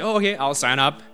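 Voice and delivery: usual speaking voice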